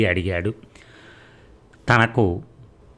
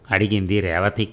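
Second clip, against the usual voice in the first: ordinary delivery, nothing marked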